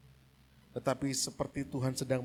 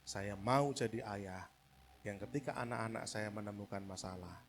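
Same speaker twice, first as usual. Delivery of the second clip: sincere reflection; 4 semitones lower, 5 dB quieter than usual. Real, not acted. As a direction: read it like a quiet confession. Reads a quoted sentence softly. (renungan tulus, pelan dan lembut)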